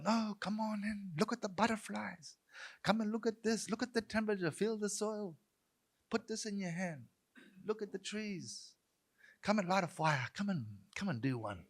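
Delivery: high, light, coaxing voice; welcoming invitation. Retold or imitated. Practitioners inviting residents to explore the burn, high welcoming voice. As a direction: invite them in gently, pitched well up.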